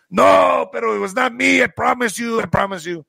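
high-pitched, insistent denial; mock pleading. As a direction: imitate an accused man begging to be believed. Accused man pleads his innocence.

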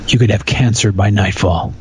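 normal talking voice

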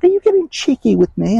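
mock British scolding, pitched high; high mock British mum telling kids off; British mom scolding. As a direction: play the cross British mom, a bit high. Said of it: "and she was British".

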